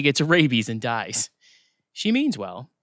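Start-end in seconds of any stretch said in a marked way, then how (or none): none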